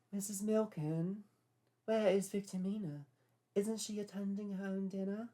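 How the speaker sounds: put-on high, genteel old English lady; posh older lady. A narrator's reading. Babcock voiced.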